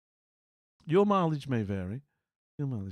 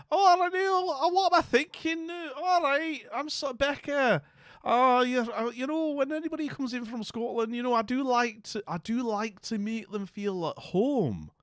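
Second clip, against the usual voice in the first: much higher, chirpy Scottish auntie voice; mock Scottish welcome, pitched way up; Scottish accent; 7 semitones higher than usual. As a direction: gush over Becca like a Scottish auntie. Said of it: "I've been no doing the accent".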